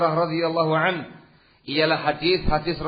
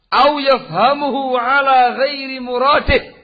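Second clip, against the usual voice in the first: fiery preaching; 8 semitones higher, 9 dB louder than usual. Real, not acted. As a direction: proclaim the rule. Preaching at full force. (ceramah lantang, lebih tinggi dan keras)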